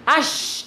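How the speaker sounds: "Ash" with a rough, strongly hissed sh; hissing shin. Shown as a demonstration. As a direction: push air hard through the teeth on "Ash". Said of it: "Ada angin lesus di mulut jenengan".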